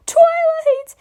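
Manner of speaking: high, loud cry of her name. Acted. Spike crying Twilight's name in his sleep, high and loud. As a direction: scream her name from the dream.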